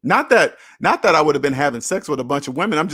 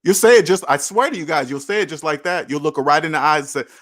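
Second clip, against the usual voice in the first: ordinary delivery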